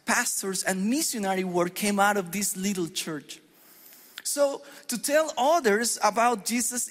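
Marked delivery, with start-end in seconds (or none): none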